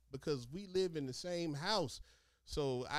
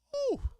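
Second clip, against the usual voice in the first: high pained exhale; pained whew; 9 dB louder than usual. Acted. Imagining painful peeing.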